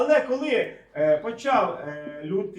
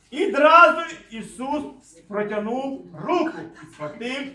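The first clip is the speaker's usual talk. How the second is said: slow declamatory Bible reading, swelling then settling; scripture reading; 2 semitones higher, 10 dB louder, slower than usual. Acted. Preacher reading how Jesus rescues and rebukes, slow solemn declamation. Reads scripture slowly and emphatically.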